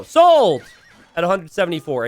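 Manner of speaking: bang out the sale; auctioneer. Pretend. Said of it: "casting this like an auctioneer".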